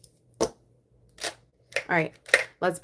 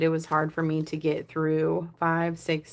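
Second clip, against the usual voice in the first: ordinary talk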